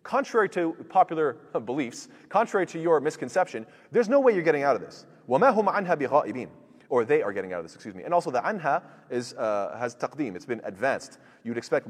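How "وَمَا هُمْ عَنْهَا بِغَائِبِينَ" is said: fast, chanted recitation, a little louder; Quran recitation. Acted. Teacher reciting the verse to students, chanted and fast.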